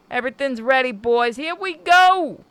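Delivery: loud, high Brooklyn boss rallying; rally the crew like a New York wiseguy; Italian New Yorker accent. Acted. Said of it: "fucking Italian New Yorker".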